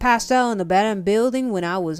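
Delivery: loud, hearty character voice; Gran the tiger. Acted. Tiger regular orders breakfast from the dragon, loud and hearty.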